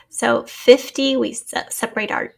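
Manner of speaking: ordinary talk, nothing marked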